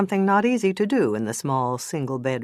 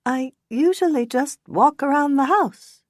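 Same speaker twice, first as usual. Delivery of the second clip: higher, weak excuse; lamely; 10 semitones higher than usual. Acted. Witness lamely excusing herself to the bad cop. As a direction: offer a feeble excuse. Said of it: "Sanna said lamely".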